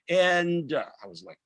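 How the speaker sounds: ordinary talk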